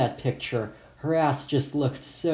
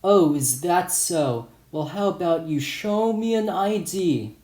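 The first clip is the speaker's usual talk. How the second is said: skeptical; 5 semitones higher than usual. Retold or imitated. Shopkeeper doubts the kid's age, skeptically. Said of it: "The owner said in a skeptical voice".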